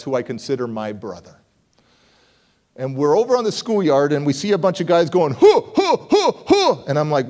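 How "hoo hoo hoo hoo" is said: high, loud imitated hooting; crowd hoots. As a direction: mimic a hyped crowd calling out. Crowd chanting at a battle.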